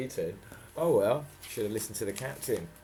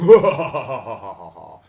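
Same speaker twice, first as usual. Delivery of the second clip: loud hammed-up villain cackle; villain laugh; 18 dB louder than usual. Captain mocking the children, loud cackle. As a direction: throw back a big gloating laugh. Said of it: "heard the captain laughing".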